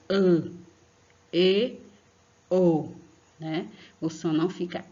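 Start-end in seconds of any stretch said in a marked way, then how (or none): 0.1-2.9 ã, e, o said loud and drawn out as closed vowels; closed vowel demo. Shown as a demonstration. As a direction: sound out ã, e, o clearly for the class. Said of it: "A gente lê". (vogais fechadas, bem pronunciadas)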